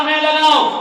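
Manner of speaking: loud, high challenge; quarrelling shout. Retold or imitated. Son yells at his mother while they fight over the broom. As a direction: yell it mid-tug-of-war.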